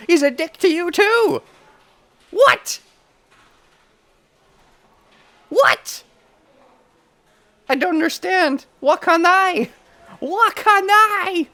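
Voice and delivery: very high, loud indignant scream; outraged shriek. Real, not acted. Streamer shrieking at the classmate insulting the protagonist. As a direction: scream your outrage at him.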